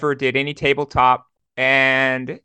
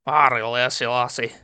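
Scottish accent; 2 semitones lower than usual. Shown as a demonstration. For the host, the dwarf's Scottish accent on request. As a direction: roll it out like a burly Scottish dwarf. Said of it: "give us a taste of the Scottish accent".

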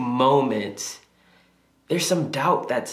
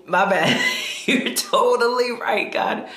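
sheepish laugh, high, giving in; laughing concession; 9 semitones higher than usual. Real, not acted. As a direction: laugh it off as you admit defeat. Laughing admits God was right.

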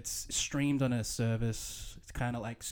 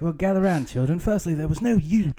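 mock elder storyteller; 6 semitones higher, 5 dB louder than usual. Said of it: "You would be like".

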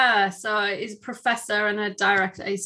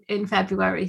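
usual speaking voice

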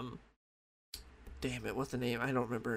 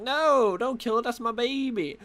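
much higher, pleading mock mother voice; hammed-up high plea of a mother protecting her child; 9 semitones higher, 3 dB louder, slower than usual. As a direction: beg them to spare the baby, pitched way up. Hera pleading for her baby.

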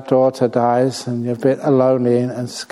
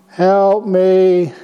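higher, louder ghostly cry for help; pleading ghost voice; 6 semitones higher, 4 dB louder than usual. Acted. Ghost girl cries out for her mother to help, eerie and pleading. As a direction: plead like a ghost calling through a dream. Mother calling the dead daughter.

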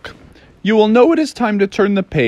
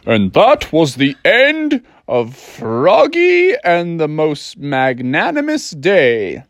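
slow, grand finish to a bedtime tale; a dad drawing out his story's ending; storytelling dad; slower than usual. Acted. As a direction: round off the tale grandly and slowly. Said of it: "he was tucking him in, and he was like".

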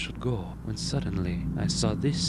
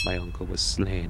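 ordinary delivery

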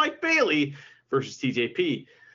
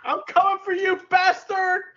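high, loud hammed-up heel taunt; buddy mimics the wrestler's taunt, loud and hammy; mock wrestler threat; 12 semitones higher, 5 dB louder than usual. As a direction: yell it like a cocky wrestler calling out a rival.

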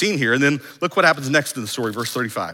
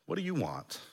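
very soft, unhurried asking; soft searching question; 2 semitones higher, 13 dB quieter than usual. Acted. Preacher asking all of us this morning, soft searching question. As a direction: let the question land quietly.

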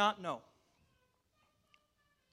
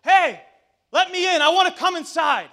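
calling up to window; 9 semitones higher, 14 dB louder than usual. Retold or imitated. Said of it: "I was picturing him throwing pebbles".

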